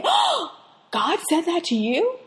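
high, surprised skeptical gasp; mock disbelief. Skeptical congregant questioning the preacher, mock surprised. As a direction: act out a doubting listener. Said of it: "some of you might be saying".

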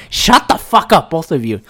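fed-up shout of shut up; angry outburst. Real, not acted. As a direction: tell them both off. Ranting at US and Iran.

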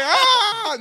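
long, high, loud "ah" held out; hammed-up wail; mock holler. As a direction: burst into an exaggerated drawn-out cry. Mock reaction to a nickname.

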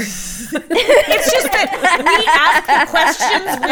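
loud, words breaking into laughter; laughing. Real, not acted. Host explaining the rapid fire joke, laughing. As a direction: laugh all through the explanation.

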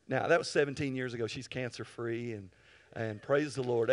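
ordinary talk

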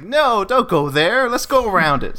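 Gollum. Retold or imitated. Creature warning hobbits off the gates, high pleading. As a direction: lightly imitate a creature's anxious warning. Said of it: "Gollum's like".

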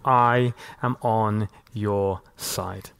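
low, soft and very slow reassurance; tender voice of God. Acted. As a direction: speak it slowly and softly, as a promise to the hurting. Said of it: "God says to you".

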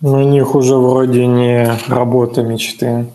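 normal talking voice

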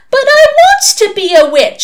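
put-on loud, very high childish wail. A narrator's reading. Little sister protesting to her torturers, wailing. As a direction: whine it out like a thwarted child. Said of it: "she wailed".

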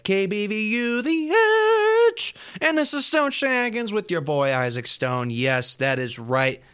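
hyped-up DJ voice; radio announcer. Acted. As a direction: bring the party energy. Radio host back from break.

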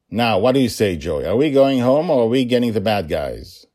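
father firing up his daughter; rallying. A narrator's reading. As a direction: build to an eager challenge.

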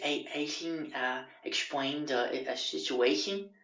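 slow, soft, halting housemate; mock a hesitant, awkward man; put-on odd voice, hesitant and quiet; imitating Justin.

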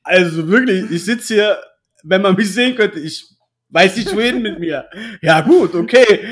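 loud, laughing mock astonishment; sarcastic amazement. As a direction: gush over it with heavy irony, laughing. Mock awe at weak Platz fünf.

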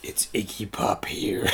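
deep, slow, breathless rock-star drawl. Retold or imitated. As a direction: go low and slow like an aging punk singer. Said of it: "It's really hard to do [name]".

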